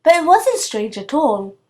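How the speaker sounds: plain reading voice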